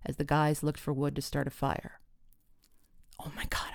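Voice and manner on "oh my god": urgent whisper to a friend; whispered, voiceless and quiet; hissed whisper. A narrator's reading. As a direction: hiss it under your breath. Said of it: "I hissed to Tris".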